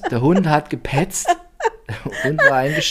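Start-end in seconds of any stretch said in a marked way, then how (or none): 2.3-2.9 squeaky laugh on the words. Real, not acted. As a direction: giggle it out high. Laughing about the sulky dog.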